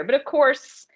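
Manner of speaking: ordinary talk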